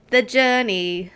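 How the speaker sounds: put-on slow, loud, overdone English accent; hammed-up English accent. Streamer reading the contents page aloud, slow hammed-up English accent. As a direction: stretch it out like a pompous Englishman. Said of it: "Made it more terrible".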